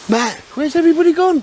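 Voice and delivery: high, loud call to a mate across camp. Real, not acted. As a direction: shout out to find where everyone went. Calling out across the campsite.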